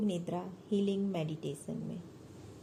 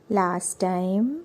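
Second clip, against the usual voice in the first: measured, soothing meditation guide; unhurried yoga nidra instruction; guided meditation; 9 dB louder than usual. Acted. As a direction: lead them into relaxation with long pauses between phrases.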